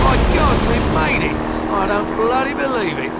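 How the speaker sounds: high, loud disbelieving relief; relieved excitement. Acted. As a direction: cry out that you made it. Relief after escaping.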